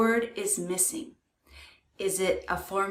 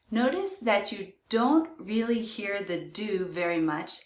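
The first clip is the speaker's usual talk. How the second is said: ordinary talk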